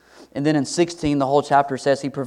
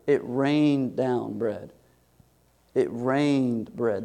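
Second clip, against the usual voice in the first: It rained bread drawn out very slowly; slow savoring; 4 dB quieter, much slower than usual. Shown as a demonstration. Preacher savoring the verse for the congregation, slow. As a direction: savor each word slowly. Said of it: "savoring verse".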